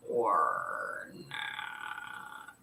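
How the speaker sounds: usual speaking voice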